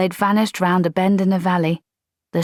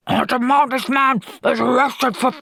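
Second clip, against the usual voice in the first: indignant corporal; 8 semitones higher than usual. Acted.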